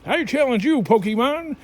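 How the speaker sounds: high, loud battle call; hammed-up anime trainer, pitched high; mock anime challenge. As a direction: call out a playful cartoon challenge.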